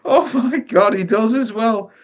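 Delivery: high, loud words through laughter; laughing. Real, not acted. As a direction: squeal it out while cracking up. Laughing at the photo.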